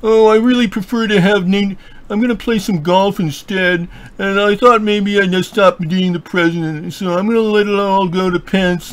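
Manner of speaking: high, put-on voice announcing he'll quit; lazy boss giving up the job, airily; mock president's voice. Host mocks the president announcing he quits, in a high put-on voice. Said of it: "New Jersey saying".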